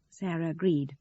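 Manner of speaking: ordinary delivery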